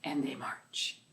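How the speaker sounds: soft storybook whisper on the last march. Acted. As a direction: drop to a hushed whisper, like tiptoeing. March refrain fading to whisper.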